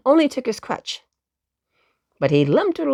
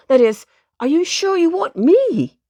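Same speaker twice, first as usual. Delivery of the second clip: puzzled; 6 semitones higher, 4 dB louder than usual. Acted. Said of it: "asked the poor old gentleman rabbit, puzzled like".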